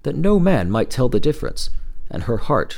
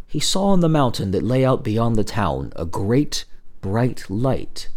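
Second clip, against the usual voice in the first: ordinary delivery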